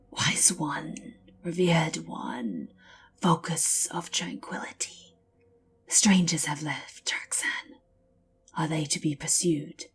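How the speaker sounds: hushed whisper to a master; reverent whisper. Acted. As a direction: whisper with awe, slow and humble. Skink scribe addresses mage priest.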